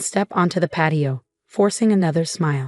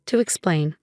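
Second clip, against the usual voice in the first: ordinary delivery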